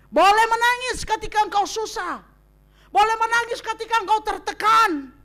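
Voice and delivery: loud, high, emphatic sermon cry; impassioned preaching. Real, not acted. Preacher urging the church that crying is allowed. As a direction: drive it home to the congregation.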